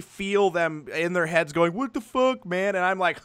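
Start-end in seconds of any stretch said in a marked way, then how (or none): 1.7-2.8 high, incredulous mock mutterer's thought; imitated bafflement. Voicing what others think in their heads, mock baffled. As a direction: pitch up, as a puzzled onlooker's imagined reaction.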